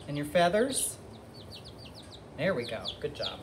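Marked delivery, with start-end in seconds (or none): none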